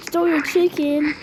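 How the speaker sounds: ordinary talk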